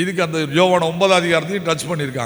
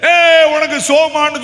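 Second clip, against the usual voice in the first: shouted, higher pitch; loud call; 9 semitones higher, 6 dB louder, slower than usual. Acted. Preacher showing shouting at a deaf man, loud high call. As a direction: yell it at someone who cannot hear. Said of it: "சொன்னா".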